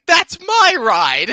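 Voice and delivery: loud, high, drawn-out mock protest; imitated indignant squabble. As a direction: act out two rivals claiming it, stretched and loud. Acting out the characters' squabble.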